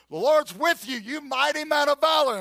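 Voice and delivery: loud, high heavenly proclamation; ringing hail to a hero; proclaiming angel. Acted. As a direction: announce it boldly, high and loud. Preacher re-enacts the angel's greeting.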